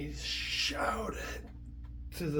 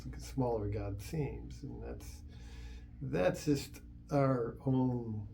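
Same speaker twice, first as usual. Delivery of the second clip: ordinary delivery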